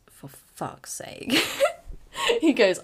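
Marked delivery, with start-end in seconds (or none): 0.1-1.3 slow, breathy whispered curse under the breath; a resigned whispered swear; exasperated whisper. Retold or imitated. Dad exasperated at bad news. 1.4-2.4 high squealing laugh; real giggle; burst of laughter. Real, not acted. Laughing at dad's reaction.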